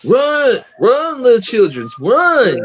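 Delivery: high, drawn-out comic cries; mock urgent calling. Viewer yelling at the film's children to run, hammed-up. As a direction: call out high, as if urging them to flee.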